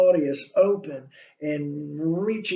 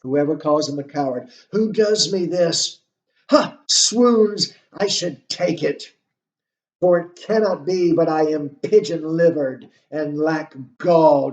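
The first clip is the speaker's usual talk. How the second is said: agitated soliloquy reading; 7 dB louder than usual. Acted. Lecturer reads Hamlet's outburst.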